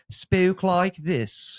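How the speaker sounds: West Country lilt on each word. Shown as a demonstration. Showing how no one originally spoke, West Country accent. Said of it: "That was very West Country".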